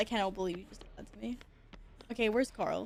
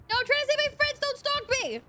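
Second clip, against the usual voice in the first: high, frightened pleading; panicked yelp; 12 semitones higher, 3 dB louder than usual. Real, not acted. Gamer fleeing a stalker, high panicked pleading. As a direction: squeal it as you run away. Fleeing pursuer in horror game.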